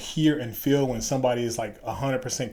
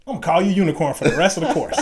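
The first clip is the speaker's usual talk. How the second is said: put-on quick, loud Southern-accented declaration; imitated Southern voice, high and fast; strong Southern accent; 6 semitones higher, 8 dB louder, faster than usual. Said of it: "strong Southern accent or whatever".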